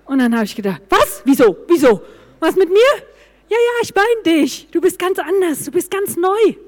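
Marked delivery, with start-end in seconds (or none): 0.9-2.9 loud, high, alarmed questions; startled, rapid-fire disbelief; shocked. Retold or imitated. Alarmed at husband's new-wife remark. 3.5-6.6 high-pitched, delighted insisting; excited retold assurance, pitched up; eager reassurance. Husband eagerly reassures her, retold.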